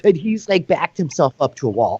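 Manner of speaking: normal talking voice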